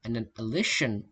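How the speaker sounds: ordinary delivery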